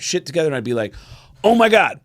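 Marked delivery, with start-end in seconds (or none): 1.4-2.0 higher, louder feigned realization; put-on "oh no" outburst; mock alarm. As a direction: act like you just remembered something urgent. Acted excuse to leave a date.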